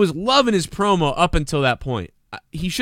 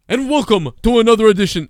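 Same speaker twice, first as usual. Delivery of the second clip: higher, louder announcer welcome; mock announcer intro; 7 semitones higher, 6 dB louder than usual. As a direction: ham up a wrestling announcer opening. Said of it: "what the fuck is that".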